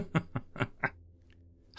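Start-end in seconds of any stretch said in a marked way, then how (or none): 0.0-0.1 breaking up with laughter on the title; cracking up mid-word; real laughter. Real, not acted.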